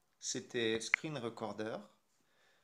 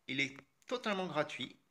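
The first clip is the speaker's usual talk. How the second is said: ordinary talk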